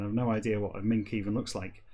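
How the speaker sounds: normal talking voice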